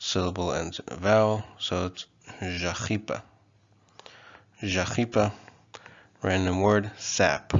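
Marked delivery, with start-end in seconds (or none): 2.4-5.3 "jachipa" with zh and throaty ch; Kotava pronunciation. Shown as a demonstration. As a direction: say "jachipa" as zha-KHEE-pa. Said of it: "we have the j".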